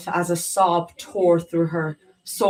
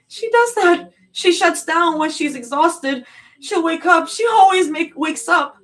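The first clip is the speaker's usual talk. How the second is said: high, loud tearful insistence; crying certainty; desperate denial; 12 semitones higher, 7 dB louder than usual. A narrator's reading. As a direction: plead in denial.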